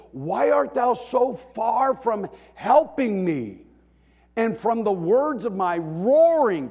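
loud, high, drawn-out cry for help; acted-out anguished bellow; bellowed plea. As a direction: roar the prayer out in desperation. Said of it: "He is bellowing out for help".

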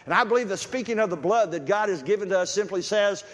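high, loud, building preacher's cry; full-force preaching. Acted. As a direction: drive the point home to the congregation.

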